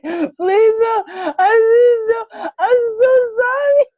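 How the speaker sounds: high, shouted, sobbing apology; sobbing plea. Retold or imitated. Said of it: "I was crying like".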